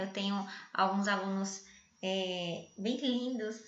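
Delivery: ordinary delivery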